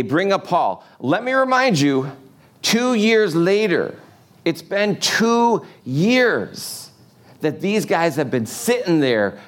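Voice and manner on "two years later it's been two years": slow, drawn-out two years, high; stress on two. Real, not acted. Preacher stressing to the congregation how long two years was. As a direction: lean hard on "two", stretching it out.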